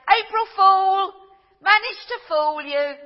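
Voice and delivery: loud, high, drawn-out April Fool call; gleeful gotcha. Retold or imitated. Child at the doorway teasing the tricked person, loud gleeful gotcha. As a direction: crow it like you caught them out.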